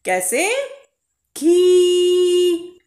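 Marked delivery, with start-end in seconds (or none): 1.4-2.8 खी drawn out high and loud; long khee. Shown as a demonstration. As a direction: stretch खी long. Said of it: "लंबी आवाज में बोलेंगे ना". (लंबी आवाज में खी)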